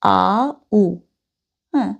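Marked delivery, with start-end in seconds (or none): none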